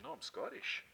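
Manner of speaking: soft whispered correction; whispered aside. Retold or imitated. Preacher telling folks he is Scottish, whispered. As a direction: breathe it out as a playful aside. Sorry, Mary.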